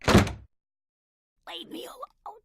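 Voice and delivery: slow, strained whisper; drawn-out whispery plea; barely audible. Acted. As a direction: force it out with no volume. Listener cannot hear, asks What.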